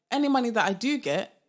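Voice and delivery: ordinary delivery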